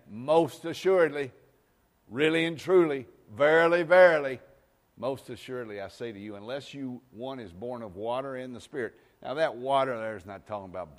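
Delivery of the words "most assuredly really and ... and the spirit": loud, high, fervent preacher cry; full-force preaching. Acted. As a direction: proclaim it like thunder from the pulpit.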